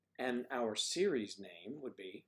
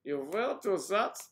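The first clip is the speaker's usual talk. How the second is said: put-on German accent, higher and louder, as a comic colonel; Colonel Klink German accent; 9 semitones higher, 7 dB louder than usual. Actor doing his old-movie German accent for viewers, comic German accent. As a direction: bark it like a sitcom German officer, German accent. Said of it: "like Kalana Klink".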